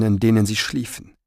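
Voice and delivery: ordinary delivery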